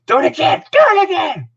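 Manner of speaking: screaming coach, high and loud; Dick Adams. Retold or imitated. Coach screaming at the freshman in practice, high loud command. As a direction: bellow it high as a repeated order. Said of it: "then he'd scream at him".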